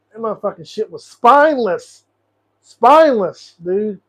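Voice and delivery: rising to a real furious shout on spineless; angry outburst. Real, not acted. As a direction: let the anger boil over. Ranting angrily about the administration.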